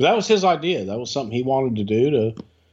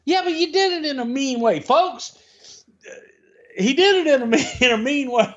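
mock whine; 11 semitones higher than usual. High mock whine, laughing.

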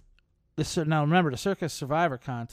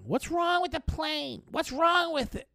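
much higher, slow, fussing squeal of a worried woman; mock panicky passenger. Passenger woman demanding answers at the gate, much higher mock panic. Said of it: "this woman was yelling".

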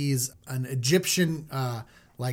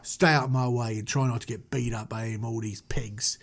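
put-on low British tough-guy accent; 5 semitones lower, 3 dB quieter than usual. Moses swearing the Hebrew to secrecy, low British accent. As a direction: warn in a lower London street voice.

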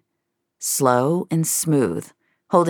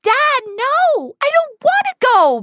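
put-on very high, loud girl's wail; shouted protest; 5 dB louder than usual. A narrator's reading. Daughter refuses to leave with her dad, high desperate shout. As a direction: shout it desperately, pitch right up. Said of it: "Mia shouted".

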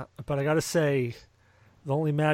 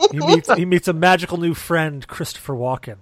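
louder trailer narrator; movie trailer voice; 4 semitones higher, 8 dB louder than usual. Pretend. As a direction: build up the trailer line.

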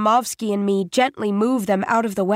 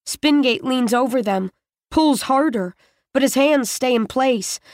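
plain reading voice

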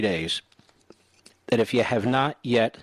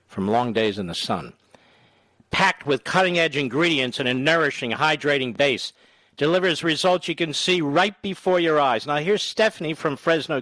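energetic infomercial pitch; 4 semitones higher than usual. Acted. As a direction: sell it like a TV ad. Host reads sponsor ad.

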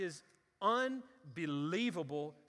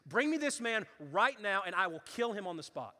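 enraged king; 4 semitones higher, 8 dB louder than usual. Acted. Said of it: "he becomes enraged".

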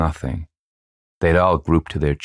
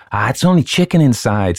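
put-on higher, louder scoffing boy; 8 semitones higher, 5 dB louder than usual. A narrator's reading. Boy debunks the witch's remains, scoffing. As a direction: dismiss it like an unimpressed boy. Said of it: "scoffed a boy".

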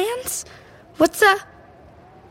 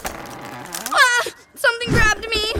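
startled fright; 6 semitones higher than usual. Acted. Grabbed by ghoul trees.

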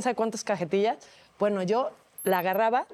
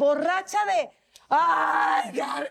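mock drunk cry; 5 semitones higher, much slower than usual. Acting out drunk reaction.

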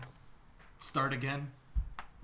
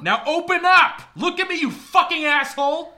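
enraged, much higher and louder yell; furious scream; 17 dB louder than usual. Acted. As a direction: scream it with murderous rage. Killer screams at victim.

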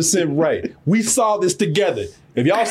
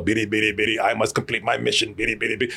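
low robotic monotone alien; deep, soft, mock sci-fi alien voice; serious alien; 12 semitones lower, 5 dB quieter, faster than usual. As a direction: drone it like a robot alien on a mission. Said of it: "one of them serious aliens".

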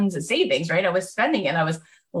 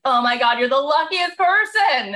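gushing friends; 6 semitones higher, 3 dB louder than usual. Retold or imitated. Friends marveling at her new life, loud and gushing.

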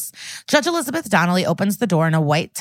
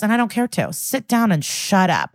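usual speaking voice

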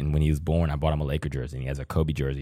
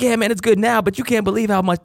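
much higher, lightly put-on boy's voice; kid voice; 3 dB louder than usual. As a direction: play the son, proud and chatty, much higher. Son answering dad in dialogue.

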